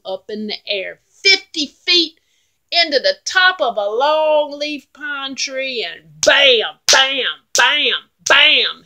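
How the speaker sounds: loud, slow, booming action telling; action shout. A narrator's reading. Narrator shouting the fight to listeners, loud. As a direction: belt out each bam. Big goat knocks troll away.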